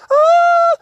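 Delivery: very high, loud scared shriek; Lofty shrieks at the mice. A narrator's reading. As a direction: shriek in fright. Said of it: "he shrieked".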